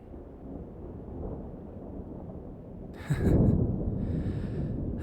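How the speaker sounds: long hushed low chuckle; low whispered chuckle. Acted. Laughs about the hard professor.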